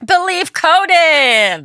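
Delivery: high, slow ad-announcer voice; mock sponsor read. Co-host naming the sponsor like an ad voice. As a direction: sell the sponsor like a radio ad.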